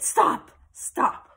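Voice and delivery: breathy loud high stop; urgent stop. Acted. Friend stopping Julie to look at the roof, urgent breathy call. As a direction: cut her off urgently.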